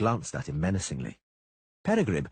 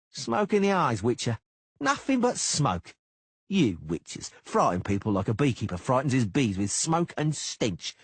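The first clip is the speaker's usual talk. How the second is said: high, loud mocking thug; taunting sneering bodyguard; Cicada voice; 4 semitones higher, 3 dB louder than usual.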